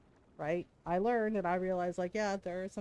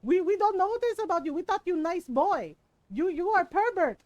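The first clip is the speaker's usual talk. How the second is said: louder, higher mock Filipino aunties piling on; a chorus of Filipino titas, raised and scandalised; chiming Filipino titas; 6 semitones higher, 5 dB louder than usual. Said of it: "all my other titas are chiming".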